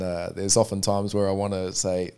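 ordinary delivery

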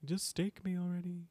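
soft, high self-pitying moan; hammed-up brooding, quietly begging to be finished off; mock brooding. Imitating Angel constantly wanting to be staked, mock brooding. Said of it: "He does brood".

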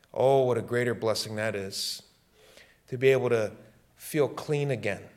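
usual speaking voice

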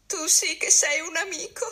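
high, whiny whimper of a frightened friend. A narrator's reading. Sick Calandrino whimpers his thanks to Bruno, whining high. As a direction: whimper it out, pleading and grateful. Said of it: "piagnucolò Calandrino".

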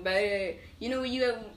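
normal talking voice

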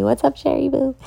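bright squeaky shout-out; high-pitched greeting. Real, not acted.

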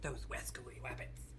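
low whispered cartoon voice with w for r; cartoon character quote. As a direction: whisper it as a sneaky cartoon hunter. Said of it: "I don't watch Looney Tunes".

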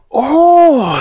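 loud, very high drawn-out exclamation; excited. A narrator's reading. Fanny hearing about the magic land, excited. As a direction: gasp it out with soaring delight.

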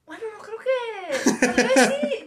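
high, loud laugh through the words; sheepish laugh admitting mom might know. Real, not acted. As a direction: giggle it out, caught. Laughs at whether mom knows.

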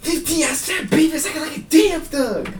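very high, shouted [name] lyrics; fast [name] flow. Shown as a demonstration. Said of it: "let me just quote some lyrics here".

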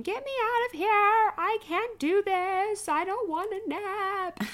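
put-on high, slow wailing child's protest; mock toddler scream. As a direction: wail it like a toddler refusing his nap. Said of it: "he would scream".

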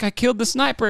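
very high, giddy retold boast; imitating excitement. Imitating the soldier who shot the sniper, all excited. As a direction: blurt it out high, thrilled at yourself. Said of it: "he was, like, all excited".

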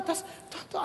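breathy whispered aside. Real, not acted. Preacher murmuring to the congregation, whispered. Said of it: "unvoiced aside".

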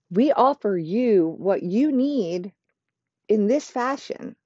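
slow, proud sales pitch; confident, proud. Shown as a demonstration. Offering a new client what they need, confident and proud. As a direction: pitch it slowly, with assured confidence. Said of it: "confident, proud sounding voice".

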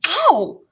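high "ow"; pained yelp. Acted. Tubby Ted cuffed mid-sentence, sudden pained yelp. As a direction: yelp as the cuff lands.

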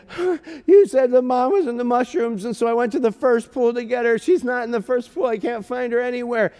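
imitating a tearful child, high-pitched; crying little boy. Lost son explaining to his father, imitated crying child. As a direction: play an upset kid explaining in a high voice. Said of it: "and he is crying".

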